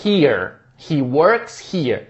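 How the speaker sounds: very slow, deliberate he works here; teacher modelling he works here; slow model. Shown as a demonstration. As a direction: say each word slowly. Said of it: "ele trabalha aqui".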